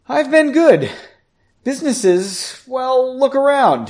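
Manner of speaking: high, loud, hearty reply; jovial. A narrator's reading. Bartender showing Henry his business, jovial. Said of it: "he laughed".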